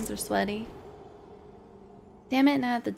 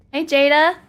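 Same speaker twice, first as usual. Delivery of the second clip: high, loud call of a name; 4 semitones higher, 13 dB louder than usual. Real, not acted. Gamer calling a greeting to Jada, loud. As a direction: call across the room to Jada.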